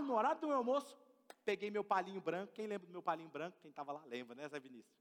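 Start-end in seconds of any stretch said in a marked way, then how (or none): none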